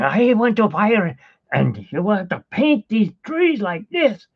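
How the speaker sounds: barking painting orders in a mock accent; gruff German painter. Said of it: "Instead of him going".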